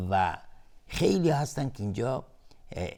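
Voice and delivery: normal talking voice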